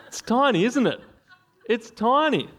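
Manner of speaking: high, laughing marvel at how tiny; amused high-pitched tease. Real, not acted. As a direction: giggle at the small size. Comparing tongue size, laughing.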